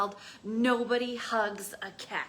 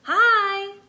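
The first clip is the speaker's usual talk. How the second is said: much higher, louder chirp; chirpy hi; 10 semitones higher, 14 dB louder than usual. Acted. Lizard greeting the cactus. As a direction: pop in brightly. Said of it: "said a lizard".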